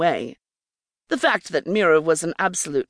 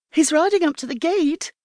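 higher, lively servant voice; Mirror; 10 semitones higher than usual. Acted. Mirror bursting in to tell Sophie, eager and higher. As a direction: announce the news eagerly.

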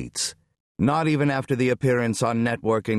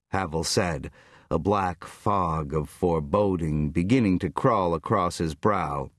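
ordinary delivery, nothing marked

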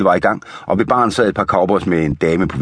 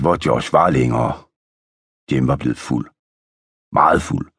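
plain reading voice

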